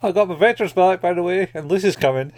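high, loud, bubbly retelling of her line; cheery girl quote. Retold or imitated. Friend retelling an anime scene, bright girlish voice. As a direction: chirp it out like a delighted girl. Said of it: "And she goes".

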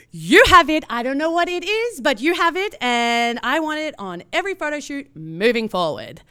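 going berserk. Retold or imitated. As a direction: gush it like you're hooked. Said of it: "She starts going berserk".

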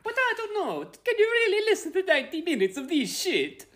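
very high squeaky cartoon falsetto; Mario voice. Co-host objecting to ninety minutes of it, in mock Mario voice. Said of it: "He doesn't have to do that voice".

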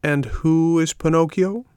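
high, slow snail voice. A narrator's reading. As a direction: ask slowly and high as the snail. Snail asks.

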